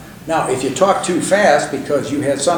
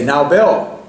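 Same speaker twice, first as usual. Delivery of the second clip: loud, mildly stern address; 6 dB louder than usual. Retold or imitated. Reg ending a phone call with advice, stern. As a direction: lightly imitate a stern mentor. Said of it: "in a very stern voice".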